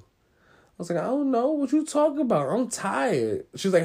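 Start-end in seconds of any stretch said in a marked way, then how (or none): 1.0-3.4 high-pitched mock innocent excuse; defensive innocence. Kid caught with snacks dodging mom, high and defensively innocent. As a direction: play dumb in a raised, defensive voice.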